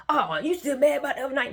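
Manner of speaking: high, loud mimicry of his complaint; mocking imitation. Imitating him brushing off her anger, mocking.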